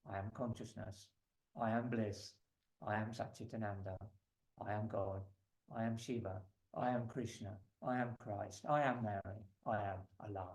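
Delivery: low, soft recitation in even short phrases; hushed litany. Shown as a demonstration. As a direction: recite each one quietly, like a private affirmation. Recites a list of divine names.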